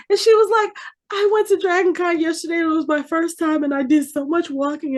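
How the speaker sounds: imitated daughter gushing to her idol; starstruck gush. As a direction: gush like a giddy teen.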